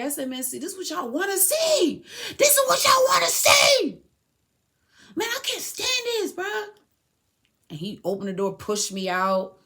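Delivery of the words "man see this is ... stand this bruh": high, loud, straining yell; escalating high-pitched protest shout; reenacted outburst. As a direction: cry out in frustration at the whole room. Acting her outburst while handcuffed.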